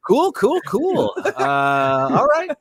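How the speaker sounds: high, loud, awkward cool-cool voice; awkward high goodbye. Retold or imitated. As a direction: brush them off with forced cheer.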